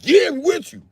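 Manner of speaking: very high loud eager cheer; high excited mock agreement.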